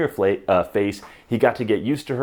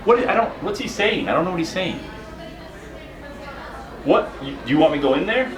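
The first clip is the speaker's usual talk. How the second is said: raised voice over loud noise; shouting over wind; 12 semitones higher than usual. Pretend. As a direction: call out over the racket. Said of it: "I can't hear you, it's too loud".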